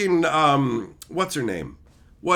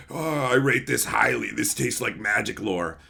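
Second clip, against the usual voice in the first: lower, softer mock tasting; mock connoisseur; 6 semitones lower, 4 dB quieter than usual. Mocking a fan savoring bad lore. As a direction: savor it like fine wine. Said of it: "You probably enjoy the taste".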